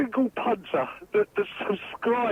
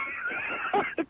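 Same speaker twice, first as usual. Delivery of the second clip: very high shriek of laughter; helpless squeal; 7 dB quieter than usual. Real, not acted. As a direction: caller losing it completely.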